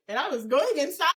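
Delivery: high-pitched, giggly retelling; tickled. Real, not acted. Friend recalling everyone pulling up at the house, tickled. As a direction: tell it on the edge of laughter. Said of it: "you were so tickled".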